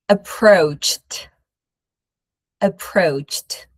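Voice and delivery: approached ending in a clipped cht. Shown as a demonstration. Teacher modelling approached for students to repeat. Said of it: "practice the pronunciation".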